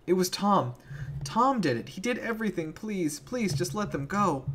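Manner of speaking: Billy pleading with Penny to spare them; desperate pleading. Acted. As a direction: beg for the other kids' lives.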